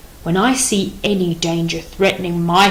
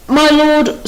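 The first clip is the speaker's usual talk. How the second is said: a higher, louder, deferential address; respectful elder; 7 semitones higher, 5 dB louder than usual. Acted. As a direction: call out to the king with respect. Chorus addresses the king.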